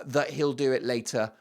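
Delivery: usual speaking voice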